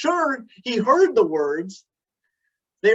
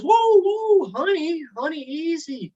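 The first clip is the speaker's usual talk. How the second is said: calming his wife; 9 semitones higher, slower than usual. Retold or imitated. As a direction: try to calm her down. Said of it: "And Frank says".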